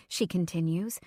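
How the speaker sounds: ordinary delivery, nothing marked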